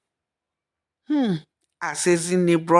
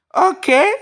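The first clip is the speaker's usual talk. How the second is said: very high rising okay; a sharp, high questioning okay; mother-in-law vowing to find out, high sceptical okay; exclaiming; 13 semitones higher, 4 dB louder than usual. A narrator's reading. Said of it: "she exclaimed".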